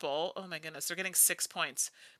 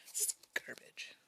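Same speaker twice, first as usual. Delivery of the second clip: hushed, voiceless complaint; disgusted whisper under the breath; whispered grumble; 14 dB quieter than usual. Real, not acted. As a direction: whisper it to yourself in dismay. Mutters at a bad hand of cards.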